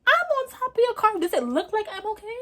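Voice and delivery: high, loud sarcastic retort; to the driver asking if she's okay, exasperated. Retold or imitated. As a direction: snap back at the obvious question.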